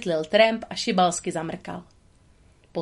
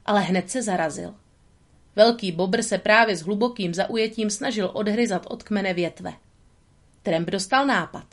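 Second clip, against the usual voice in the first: usual speaking voice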